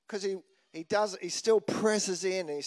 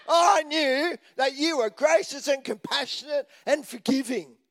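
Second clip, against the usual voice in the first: higher, complaining mock accusation; whinging Jonah; 6 semitones higher than usual. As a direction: throw it at God like a grievance.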